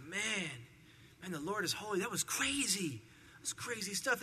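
softly voiced, stunned burial crew; hushed amazement. Burial crew coming back talking among themselves, hushed amazement. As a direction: act out awed disbelief under your breath.

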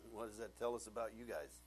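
usual speaking voice

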